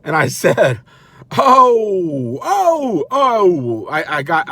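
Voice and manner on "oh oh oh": loud, high Jersey "oh"; mock Jersey protest, drawn-out ohs; Jersey outrage. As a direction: holler it like an offended Jersey guy. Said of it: "I became from Jersey at that point".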